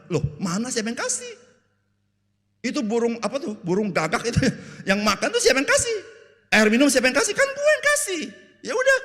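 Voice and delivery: very high, loud, incredulous voice; God listing his gifts in mock disbelief; a hammed-up exasperated God; indignant God. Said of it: "Tuhan bilang".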